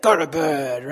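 put-on low growled order to a kid; mock-stern, pitched well down; stern parent. Parent ordering someone else's kids to bed, stern.

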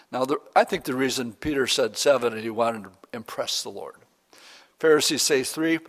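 usual speaking voice

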